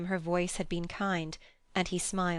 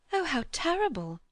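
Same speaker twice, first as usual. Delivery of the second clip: higher, horrified cry; shocked exclamation; 9 semitones higher than usual. A narrator's reading. Young woman hears of the mother's death, higher and shocked. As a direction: gasp it out in dismay. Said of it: "said Lucy".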